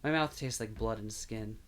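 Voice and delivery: plain reading voice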